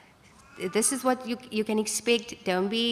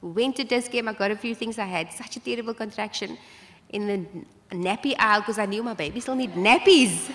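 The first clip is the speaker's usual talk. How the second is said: ordinary talk, nothing marked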